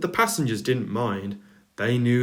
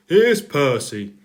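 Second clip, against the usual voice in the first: loud, carrying voice; the Fat Controller calling to Edward about Percy; 4 semitones higher, 7 dB louder than usual. A narrator's reading. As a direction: keep calling across the yard. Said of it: "he called".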